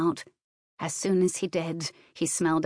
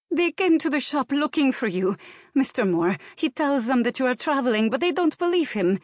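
put-on high, agitated Slavic-accented maid; thick Slavic accent; 7 semitones higher, 3 dB louder than usual. A narrator's reading. Maid telling employer they came looking, agitated Slavic accent. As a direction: warn him urgently in a Slavic accent. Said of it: "her accent growing stronger".

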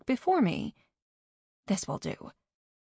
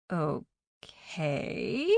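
drawled; 2 semitones lower, 5 dB quieter than usual. A narrator's reading. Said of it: "she drawled".

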